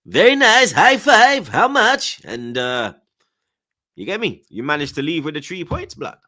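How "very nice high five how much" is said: high, loud mock Kazakh accent; Borat. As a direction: put on an excited comic Kazakh accent, pitched way up. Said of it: "You went to see Borat and his cousins".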